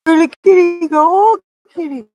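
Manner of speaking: high, loud kitty call; calling a cat. Real, not acted.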